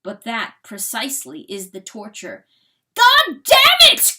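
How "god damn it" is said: very high, very loud drawn-out curse; furious cry. A narrator's reading. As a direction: scream the curse slowly in fury. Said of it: "cries Chanticleer".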